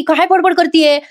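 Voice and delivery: high-pitched puzzled aside; bewildered. Acted. Husband baffled by what wife is babbling, puzzled. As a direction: wonder at her in acted confusion. Jiva baffled, thinking to himself.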